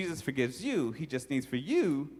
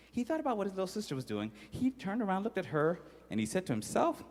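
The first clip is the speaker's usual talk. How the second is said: normal talking voice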